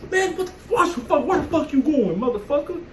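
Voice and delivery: high, sharp confrontational yell; retold angry yell. Guy who bumped me gets cursed out, high angry yell. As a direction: snap at him like he just shoved you.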